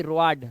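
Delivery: wrong two-vowel रोआड; misreading of road. Shown as a demonstration. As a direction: read रोआड wrongly as ro-aad. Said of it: "रोआड नहीं".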